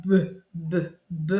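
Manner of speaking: "b" repeated as short separate bursts; isolated B sound. Shown as a demonstration. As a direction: bounce the "b" as buh, with pauses. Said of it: "si j'étire bien".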